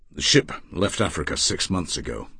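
low, coldly restrained statement. Acted. Erasmus rejecting the older man's kindness, coldly. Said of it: "he said coldly".

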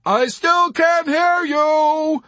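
high, slow, loud call; innocent calling back. A narrator's reading. Arfi to Yossarian with mild perplexity, innocent loud call. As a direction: call back blithely over the noise. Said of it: "Arfi called back innocently".

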